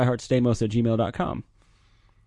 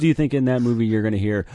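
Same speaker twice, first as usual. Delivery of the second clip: ordinary talk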